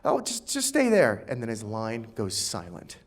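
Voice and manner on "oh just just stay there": put-on high, loud pleading little kid; whiny kid voice. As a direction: beg like a persistent eleven-year-old. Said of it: "he goes".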